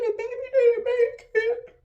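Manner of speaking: high, strained tearful words. Real, not acted. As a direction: force the bad news out crying. Telling husband baby died.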